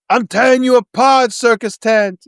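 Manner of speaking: loud high snarling threat; angry roar. Acted. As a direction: roar it like a furious monster. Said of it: "a very kind of room-esque".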